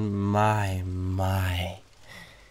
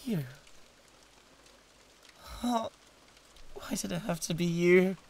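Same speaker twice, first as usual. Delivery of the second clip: dismayed recognition; 8 semitones higher, 6 dB quieter than usual. Acted. Rival demon recognised with dismay.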